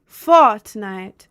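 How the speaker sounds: loud mock-British "Fortnite" with dropped R; British accent, punched hard on the first syllable. Shown as a demonstration. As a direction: belt it out in a put-on British accent. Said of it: "British accent".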